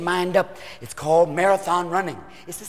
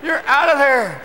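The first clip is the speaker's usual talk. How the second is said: umpire call; 5 semitones higher than usual. Acted.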